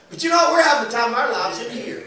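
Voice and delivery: ordinary delivery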